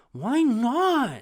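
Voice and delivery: high, loud kiddie whine; mock childish outburst; protesting child. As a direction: yell it like a thwarted little kid. Imitating himself protesting as a child.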